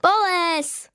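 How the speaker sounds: put-on a girl shouting encouragement up at her brother; calling out. A narrator's reading. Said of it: "volá na Jonáše".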